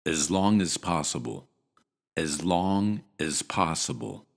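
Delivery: as long as possible with short as; natural reduced as; reduced form demo. Shown as a demonstration. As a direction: rush the as, stretch long. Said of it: "shorten it to".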